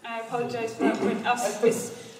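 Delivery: ordinary talk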